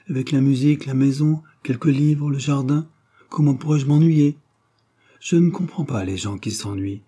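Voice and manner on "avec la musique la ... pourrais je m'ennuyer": higher-pitched listing, building to a protest; a touch of lively conviction; lightly voiced Hélène, animated. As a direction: count off your pleasures in a lifted voice. Hélène lists her pleasures.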